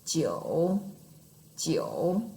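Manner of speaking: "jiu", each falling very low then rising; third tone, dipping. Shown as a demonstration. Teacher showing number nine in third tone, low dip then up. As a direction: sink "jiu" right down, then bring it back up. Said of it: "That's our third tone".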